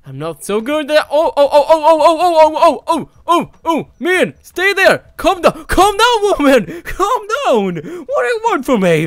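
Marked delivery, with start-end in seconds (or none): 1.1-9.1 high, loud frantic yelps; laughing panic. Real, not acted. Gamer chased by the owner, laughing panic. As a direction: squeal while being chased.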